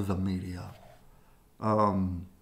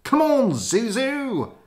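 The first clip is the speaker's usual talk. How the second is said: high calling voice; 12 semitones higher, 7 dB louder than usual. Acted. Calling to dog.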